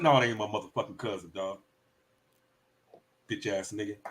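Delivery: whispering so the cousin won't hear; hushed denial. Acted. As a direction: deny it under your breath. Acted scene denying cousin.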